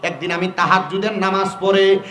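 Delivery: ordinary delivery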